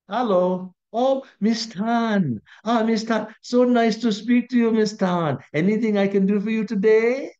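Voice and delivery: gushing, high and loud phone greeting; exaggerated charm. Husband greeting lady colleague on the phone, hammed-up gushing charm. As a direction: lay on the delight, drawn-out and sing-song. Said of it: "You see the difference? It's way too big".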